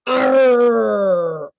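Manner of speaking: loud, long pirate arr. Shown as a demonstration. Leader getting everybody to join in, loud pirate growl. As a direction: belt out a drawn-out pirate arr. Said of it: "Okay, you ready?".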